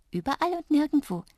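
high, playful little voice; giggly. A narrator's reading. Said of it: "kicherte Drups". (kichernd, mit hoher Stimme)

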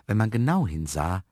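plain reading voice

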